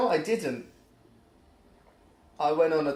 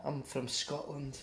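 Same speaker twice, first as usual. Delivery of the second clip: imitated Scottish lad; 2 semitones lower, 10 dB quieter than usual. Scottish kid introducing himself on the bus, imitated soft voice. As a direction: trail softly through it as a meek introduction. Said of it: "this kid got up and was just like".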